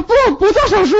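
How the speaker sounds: very high delirious mock protest; feverish babbling. Feverish wife answering husband's hospital offer, high muddled mock protest. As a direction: act out muddled fever panic. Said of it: "烧迷糊了".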